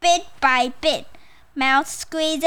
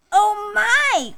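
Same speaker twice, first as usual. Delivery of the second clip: high-pitched mouse exclamation; high, drawn-out "oh my" of surprise; crying out; 9 semitones higher, 4 dB louder than usual. Acted. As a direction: cry out in storybook alarm. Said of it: "cried Mouse".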